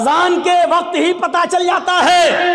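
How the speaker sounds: high, ringing preacher's shout; loud proclamation. Real, not acted. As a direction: proclaim it to the whole congregation. Repeats it as proclamation. (बुलंद आवाज़ में ऐलान)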